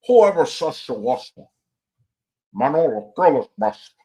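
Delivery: hammed-up Cuban gangster accent; loud, mock Cuban crime boss; Tony Montana. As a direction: order a henchman in a bad Cuban accent. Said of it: "He's like".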